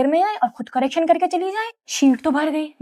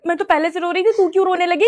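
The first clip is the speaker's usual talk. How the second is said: usual speaking voice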